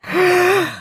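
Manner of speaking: gasp for breath. Acted. Breath after long name list.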